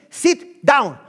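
loud firm order; loud firm command. Acted. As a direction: plant the order. Boss commands.

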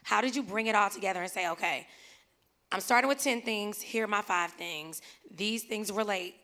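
ordinary delivery

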